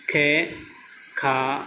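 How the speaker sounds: खे and खा said slowly one at a time; reciting syllables. Shown as a demonstration. Teacher reciting the ख row to students, slow and clear. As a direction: separate each syllable clearly. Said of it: "देखिए आगे".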